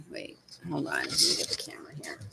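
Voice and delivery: hushed whisper. Real, not acted. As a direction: whisper it so the dog stays calm. Whispering while handling pet.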